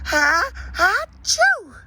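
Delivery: a big, slow, drawn-out sneeze. Acted. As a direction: build up then let the sneeze burst out high. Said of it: "she sneezed".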